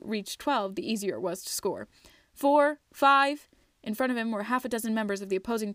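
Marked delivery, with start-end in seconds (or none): 2.3-3.5 loud, higher shout of the numbers; yelling the count. A narrator's reading. Twig shouting his count as opponents close in, loud. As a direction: bellow the count across the pitch. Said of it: "he yelled out".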